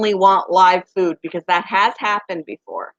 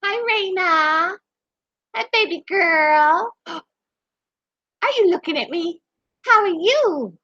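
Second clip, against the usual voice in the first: pet talk; 8 semitones higher than usual. Real, not acted. Greeting the son's pet rat, slow high baby talk. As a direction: coo lovingly at the rat.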